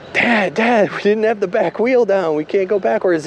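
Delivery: put-on high-pitched, urgent child pleading; alarmed kid. Child telling dad about the wheel, high and alarmed. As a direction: imitate a worried kid protesting to dad.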